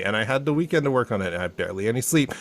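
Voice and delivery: rapid caffeinated rattle; talking really fast. Real, not acted. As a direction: rush through it without a breath. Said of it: "I'm talking really fast".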